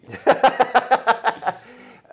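high, loud burst of laughter; loud, high cackling laughter; real laugh. Real, not acted. Laughing at congressman's joke.

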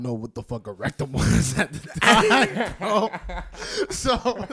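telling it through loud laughter; laughing. Real, not acted. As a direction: crack up while telling the story.

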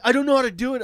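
high, loud stammered excuse; imitated helpless rider. Rider excusing himself to driver, mock helpless. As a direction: mimic a clueless, flustered passenger.

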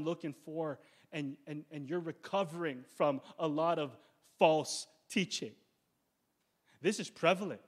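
usual speaking voice

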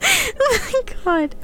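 cracking up, high and breathless; too overcome with real laughter to get words out; helpless laughter. Real, not acted. Reader losing it over a flubbed word, laughing helplessly.

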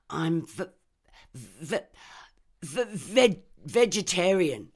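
high, loud stammered words; stammering. Acted. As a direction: stammer it out nervously. Reb stammers under threat.

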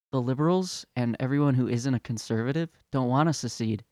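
normal talking voice

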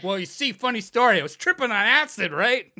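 loud, high, chirpy storytelling pitch; sarcastic, overeager explaining; mock confession. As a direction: launch brightly into an absurd excuse.

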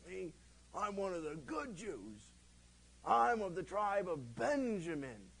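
bragging Paul. Retold or imitated. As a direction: puff up like Paul claiming his good lineage. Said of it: "Paul brags a couple times".

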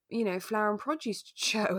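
ordinary talk

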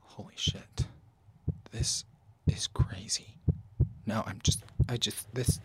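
hushed, breathless whisper; awed whisper. Acted. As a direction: whisper it in stunned awe. Stunned by the drug trip.

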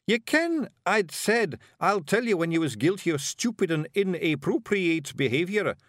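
high-pitched Scots telling-off; Scottish character voice, reproving; scolding Scottish Feegle leader. Acted. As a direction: play an exasperated wee clan chief. Rob scolds Daft Wooly.

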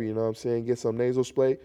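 ordinary delivery

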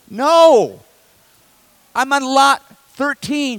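high, loud exasperated cry. Retold or imitated. Pastor to mortgage lender, exasperated and loud.

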